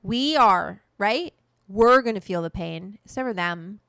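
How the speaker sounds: loud, indignant rant; heated. Real, not acted. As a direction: hit it hard and angry. Angry rant about politicians.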